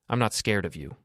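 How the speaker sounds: ordinary delivery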